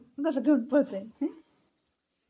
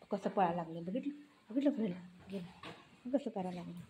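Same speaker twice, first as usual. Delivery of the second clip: hushed aside to the viewer; amused quiet aside; 4 semitones lower, 11 dB quieter, faster than usual. Real, not acted. Mother aside to viewers about the pet, hushed. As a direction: murmur it almost under your breath.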